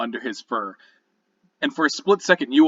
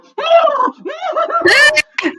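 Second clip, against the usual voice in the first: high, loud panicked horse whinny; horse scream; 9 dB louder than usual. Retold or imitated. Horses shriek at the feeding cat. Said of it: "all the other horses are just like".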